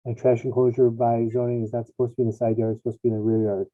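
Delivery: muffled headset mic; hollow, distant, like in a tunnel; sounds like in a tunnel. Real, not acted. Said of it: "you sound like you're in a tunnel".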